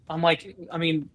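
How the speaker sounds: ordinary delivery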